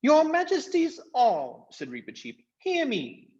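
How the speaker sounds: a tiny eager swordsman; valiant mouse Reepicheep. Acted. Reepicheep eager to fight the invisible foes, very high.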